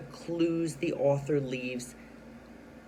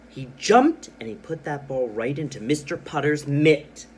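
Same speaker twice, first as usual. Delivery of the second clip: excited reading; 6 dB louder than usual. Shown as a demonstration. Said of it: "So I read with excitement".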